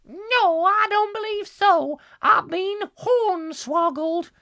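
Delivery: very high prim wife voice; shrill it up as the wife. Acted. Wife answering husband about America, very high voice.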